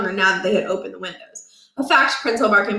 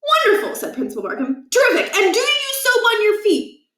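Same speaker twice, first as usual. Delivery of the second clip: much higher, bright, overeager praise; falsely cheerful; 12 semitones higher than usual. A narrator's reading. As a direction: gush like a nervous cheerleader. Principal overly cheerful questioning.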